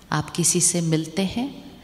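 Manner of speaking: ordinary talk, nothing marked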